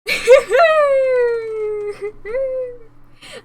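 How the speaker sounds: long, loud, high-pitched laughing squeal. Real, not acted. Streamer delighted by the game, loud high laugh.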